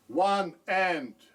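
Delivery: slow, loud, punched count on the accents; counting accented beats loud. Shown as a demonstration. Drum teacher counting the written dynamics, accents loud and slow. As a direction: hit each accented count hard and slow. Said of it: "I'd count it like this".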